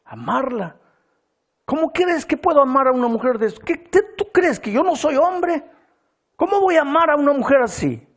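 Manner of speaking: high, loud outraged cry; like a wounded beast. Retold or imitated. Husband lashing out at the pastor, high outraged shout. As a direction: explode like a wounded animal. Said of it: "saltó como una fiera herida".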